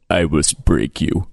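a hint of a deep Russian brute; lightly voiced menacing Russian boxer; Drago impression. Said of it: "he basically just says".

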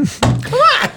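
squealing high teasing protest. Real, not acted. Host admitting he was a little flirtatious, high teasing.

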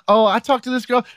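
very high put-on woman's voice; imitated girlfriend gossiping. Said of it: "her friend would say".

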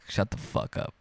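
whispered dad. Retold or imitated. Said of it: "Your dad's like".

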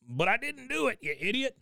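put-on a high old man voice, indignant; Cotton snapping. Cotton yelling at Dale who wants him to confess, high indignant old man voice. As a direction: bark it up high at the idiot.

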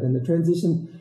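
ordinary talk, nothing marked

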